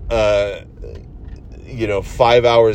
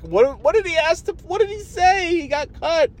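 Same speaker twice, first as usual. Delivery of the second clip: excited disbelief. Real, not acted. Frantic disbelief at missed call.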